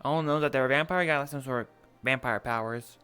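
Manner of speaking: ordinary delivery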